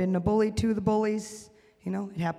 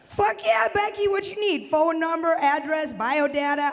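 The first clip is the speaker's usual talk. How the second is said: mock eager acceptance; 7 semitones higher than usual. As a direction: jump at the offer, voice way up. Eagerly accepts fan's matchmaking.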